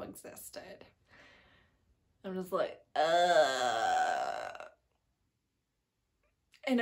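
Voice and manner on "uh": a deep, dismayed uh; low groan of dismay; low disgusted grunt. Retold or imitated. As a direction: grunt at a bad plot twist. Disgust at the plot twist.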